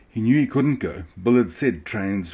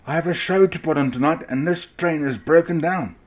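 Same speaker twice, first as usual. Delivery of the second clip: high, loud angry shout; 9 semitones higher, 5 dB louder than usual. A narrator's reading. Ringmaster shouting at Grumpy McGrump's driver. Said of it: "he was shouting".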